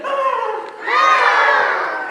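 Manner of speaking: high loud hammed-up shriek; mock scream. King hearing his fate, loud mock shriek.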